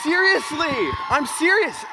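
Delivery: loud, high-pitched insistence; insistent protest. Real, not acted. Speaker insisting to the audience his sisters are savages, loud protest. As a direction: raise pitch and volume right up to insist over the crowd.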